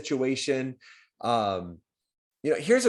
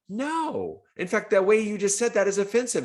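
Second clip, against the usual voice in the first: much higher, indignant mock pushback; hammed-up offended reply, louder and much higher; offended listener; 12 semitones higher, 6 dB louder than usual. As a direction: play the offended person snapping back at a clumsy guess. Voicing an offended listener pushing back.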